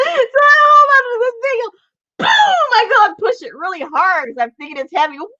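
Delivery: high, loud squeal through laughter; hysterical laughing retelling. Real, not acted. Woman reliving pushing the trash bin, shrieking with laughter. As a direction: act out the mishap while cracking up.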